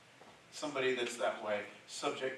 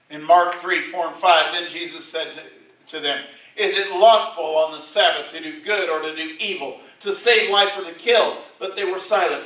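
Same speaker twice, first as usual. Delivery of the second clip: loud, raised preacher's voice quoting scripture; preaching at full force; 5 semitones higher, 15 dB louder than usual. Acted. Preacher reading Jesus' words to the congregation, booming. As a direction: proclaim it to the back pews. Preacher retelling synagogue healing.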